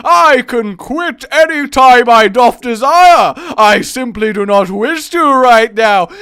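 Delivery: epic intonation. Acted. Addict refusing to quit intoning, loud epic intonation. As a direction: intone it loudly in mock-epic protest. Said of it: "quit the intonation".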